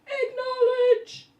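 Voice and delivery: very high, loud, slow drawn-out shout; mother shouting at kids who ignore her, mock yell; mock bellow. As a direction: bellow it up the stairs, hammed-up. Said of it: "and then I'd hear".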